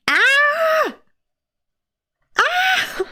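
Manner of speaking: high, held pained wail; cry of pain. Real, not acted. Reader whose entire underarm hurts, high pained wail. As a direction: yelp high from a sore arm. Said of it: "It hurts".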